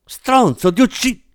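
a father screams at the man who hurt his son, in fury; angry father yelling. Acted. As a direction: yell at the attacker, then break off. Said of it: "urlò il padre".

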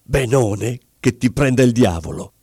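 plain reading voice

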